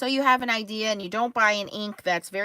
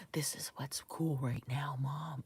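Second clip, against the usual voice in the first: daughter's hushed ASMR whisper; breath it out like into a mic; imitated whisper; 8 semitones lower, 13 dB quieter than usual. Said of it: "whispering into the microphone".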